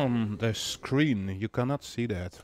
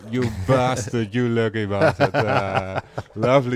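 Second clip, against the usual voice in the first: laughing; 5 dB louder than usual. Real, not acted. Friend wins the raffle.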